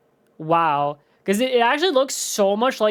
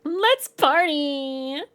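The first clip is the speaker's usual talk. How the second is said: mock party voice; 8 semitones higher, much slower than usual. Mimicking the animatronic's catchphrase.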